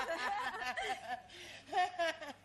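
a long laugh, tapering off; hearty laugh. Real, not acted. Host cracking up at the old lady's tale, a long fading laugh. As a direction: let a big laugh roll out and fade.

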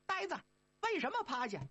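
high, quick monkey voice; high-pitched eager Monkey King. Acted. Monkey King asking brothers about master, high and quick.

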